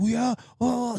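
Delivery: high-pitched mock yell. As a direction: imitate a distant man yelling, high and garbled. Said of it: "I hear him yelling".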